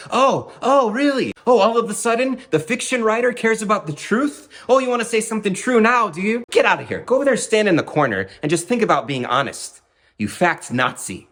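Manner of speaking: high, loud sarcastic scolding; shrill hammed-up sarcastic rant; mock tirade. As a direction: cuss him out like an offended poet. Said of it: "he was just like".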